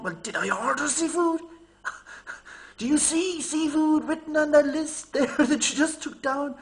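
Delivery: slowed exasperation breaking into laughter; exasperated retelling. Retold or imitated. Customer to confused waiter, exasperated and laughing. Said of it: "I'm going".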